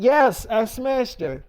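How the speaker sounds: normal talking voice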